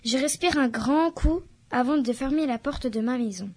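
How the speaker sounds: ordinary delivery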